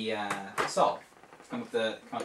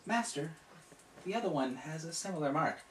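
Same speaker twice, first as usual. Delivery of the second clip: soft, higher, deferential; polite apprentice; 4 semitones higher, 6 dB quieter than usual. Acted. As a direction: murmur it with careful respect. Jolien reports to master.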